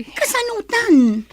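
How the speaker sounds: high, loud startled cry; alarmed, loud and high-pitched; shocked outburst. Acted. Shocked at frozen bank account.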